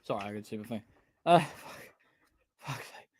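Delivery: ordinary talk, nothing marked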